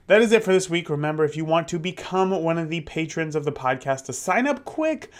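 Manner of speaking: upbeat host sign-off; podcast outro. Acted. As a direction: wrap up like a radio host.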